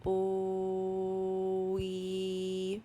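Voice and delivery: "Ooh, wee" stretched on one level pitch, unbroken; slow sounding-out. Shown as a demonstration. Teacher sounds out a word for learners, slowly. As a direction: hold each sound of "Ooh, wee" long, no gap. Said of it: "say the word slowly without stopping".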